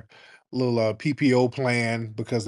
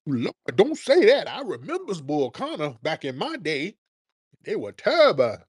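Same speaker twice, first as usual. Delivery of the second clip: high, swooping mock voice of an alarmed elder; hammed-up old-timer fretting over bad memories; scared elder reminiscing; 5 semitones higher than usual. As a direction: imitate a frightened old person, pitched up. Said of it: "getting Jim Crow flashbacks".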